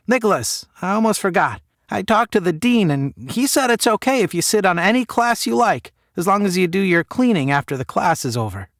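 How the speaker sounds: mother telling her son the dean said yes; Ma's voice. Acted. Ma voiced in the story.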